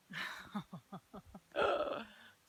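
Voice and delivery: a burst of amazed laughter; overjoyed laugh at a rainbow; real delighted laugh. Real, not acted.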